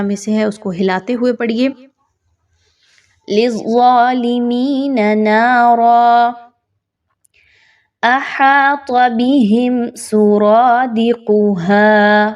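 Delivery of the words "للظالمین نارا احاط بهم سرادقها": slow melodic tajweed chant; Quranic recitation. Shown as a demonstration. Teacher reciting the verse for the class, melodic chant. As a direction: recite it slowly, drawing out each vowel.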